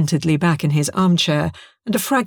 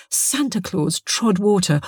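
ordinary delivery

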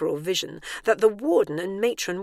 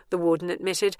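usual speaking voice

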